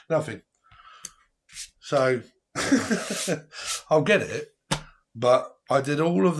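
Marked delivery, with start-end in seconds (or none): 2.5-3.8 high laugh. Real, not acted. Laugh at not getting paid.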